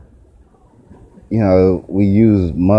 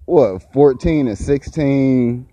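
ordinary talk

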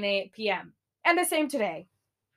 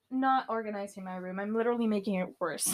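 ordinary talk

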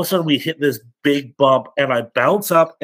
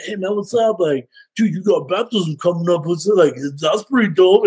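casual bro voice; 5 semitones higher than usual. Retold or imitated. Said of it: "and just be like".

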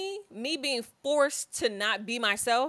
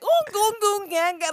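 put-on high cooing baby talk; mock goo-goo baby babble; 10 semitones higher, 4 dB louder than usual. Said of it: "I personally hate when people be like".

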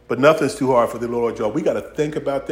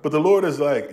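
ordinary delivery

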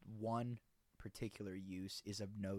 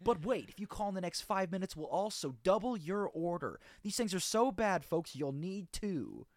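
high, loud TV pitchman; infomercial; 7 semitones higher, 6 dB louder than usual. Pretend. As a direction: hype the bonus offer. Parody infomercial upsell.

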